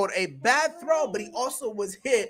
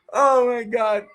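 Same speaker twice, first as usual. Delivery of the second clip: usual speaking voice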